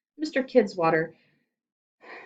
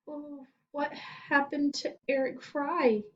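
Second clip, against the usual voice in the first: put-on nervous boy; 9 semitones higher, slower than usual.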